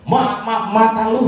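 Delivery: mock angry scold. Blind man still scolding.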